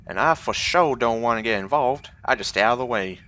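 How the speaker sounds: loud, rough Southern grumble about the thieves; Southern guard accent. Acted. Guard griping to a stranger about crime in the docks, loud Southern accent. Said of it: "for my southern accent".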